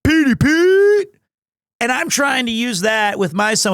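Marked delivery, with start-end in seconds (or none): 0.0-1.1 high, slow, drawn-out sing-song call; a high, slow sing-song greeting like an older schoolmate in a hallway; imitating a friend's greeting. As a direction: call it down the hall like a teasing upperclassman. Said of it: "he'd go".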